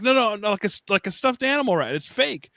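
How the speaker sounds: reassuring dad. Retold or imitated. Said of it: "And I'm like".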